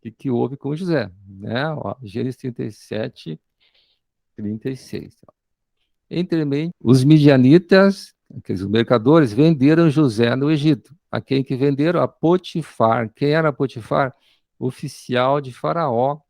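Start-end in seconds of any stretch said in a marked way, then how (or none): 6.1-16.2 full-force preaching. Acted. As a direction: proclaim it to the congregation, hammering each phrase. (pregação em voz alta e lenta)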